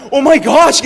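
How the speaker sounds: loud, high mock shock; shocked outburst. Academy instructor reacting to the cadet's odd salute, shocked. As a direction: exclaim it in exaggerated astonishment. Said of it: "얼마나 놀래겠습니까".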